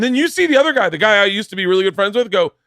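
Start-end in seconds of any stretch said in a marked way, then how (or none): none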